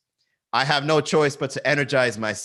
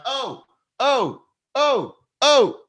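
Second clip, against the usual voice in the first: short, very high "oh" cheers; repeated high whoops, like pumping up a crowd; hype guy's whoops; 12 semitones higher, 4 dB louder, much slower than usual. Acted. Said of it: "He's waving it around".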